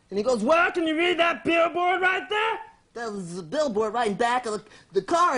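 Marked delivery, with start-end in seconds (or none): 0.4-2.6 challenging forest ranger. Acted. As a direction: demand it in a hammed-up bossy voice. Acts ranger challenging man.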